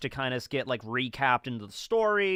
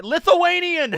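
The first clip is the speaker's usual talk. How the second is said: loud, high joking shout; 14 semitones higher, 10 dB louder, slower than usual. Acted. Streamer mimics chat shouting out nationalities, shouting out. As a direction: call it out suddenly and loudly. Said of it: "shouting out nationalities".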